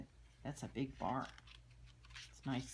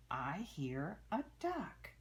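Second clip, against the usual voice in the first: ordinary talk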